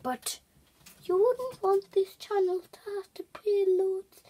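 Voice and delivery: hushed aside to viewers; whispering. Real, not acted. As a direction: whisper it confidentially.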